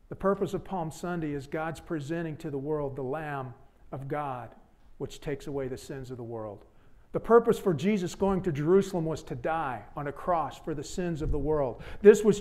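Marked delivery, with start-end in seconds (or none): 0.1-6.6 much softer, lower and reverent; preacher lowering his voice for the central truth; hushed solemn. Real, not acted. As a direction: let it sink in quietly. Solemn core statement of sermon.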